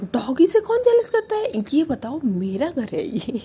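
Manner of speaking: high offended retort; indignant retort. Retold or imitated. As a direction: snap back, offended. Jealous retort to girlfriend.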